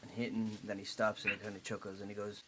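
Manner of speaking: ordinary delivery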